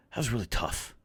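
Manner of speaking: softly imitating a let-down fan; subdued retold confession; quiet admission. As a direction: say it small and quiet, trailing down. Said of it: "quietly just goes".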